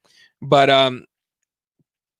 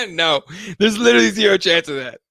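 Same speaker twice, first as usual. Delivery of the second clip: laughing disbelief; 5 semitones higher than usual. Real, not acted. Host answering a viewer's Kennedy question, real amused disbelief. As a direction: burst of laughter into a raised dismissal.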